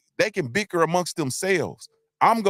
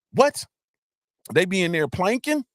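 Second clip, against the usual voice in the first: mock surprise; 4 semitones higher than usual. Mock shocked reaction to planking.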